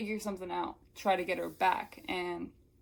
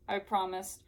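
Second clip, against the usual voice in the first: usual speaking voice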